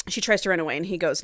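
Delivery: normal talking voice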